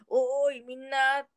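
mock searching holler; calling out. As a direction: call out for everyone, lightly acted. Mike calling missing friends, retold.

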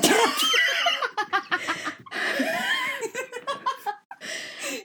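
high shrieking laugh. Real, not acted. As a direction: burst into a screaming laugh. Bitter joke cracks her up.

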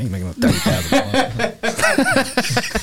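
you can't believe his claim; laughing disbelief. Retold or imitated. Friend retelling Sean's thirty-thousand-a-month boast, laughing in disbelief. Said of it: "he capped me".

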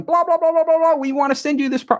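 high, rapid mock agent chatter; mock agent babble. Mocking a Hollywood agent pitching on the phone, high babble. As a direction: parody a pushy agent's pitch.

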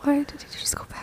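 hushed, whispered question; puzzled whisper. Real, not acted. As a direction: whisper it, puzzled, out of the neighbour's hearing. Whispering about neighbour within earshot.

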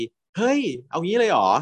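high startled gasp of disbelief; a retold shock, voiced up high; mock shocked. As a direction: act shocked, voice jumping up. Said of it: "ปานก็ตกใจ".

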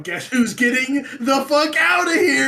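loud, high, rising in alarm and stretched out; hammed-up drawn-out holler; comic panicked announcement. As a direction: re-enact eagerly obeying the ghost. Retold reply to ghost.

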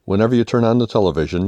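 ordinary delivery, nothing marked

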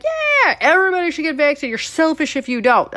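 high, loud, hurried government mouthpiece; mock government voice. Host mocks the government pushing vaccines, exaggerated high voice. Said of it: "when the government's like".

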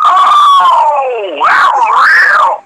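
high, slow, drawn-out mock yell; stunned wrestler. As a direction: stretch out "that" high and hurt, half laughing. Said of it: "man, and dude went".

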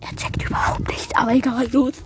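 quick whispered aside; whispering. Real, not acted. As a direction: whisper it behind their back. Aside whispered while other talks.

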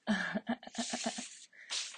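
amused burst of laughter; laughing. Real, not acted. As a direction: break into a laugh at your own joke. Laughs at own diss line.

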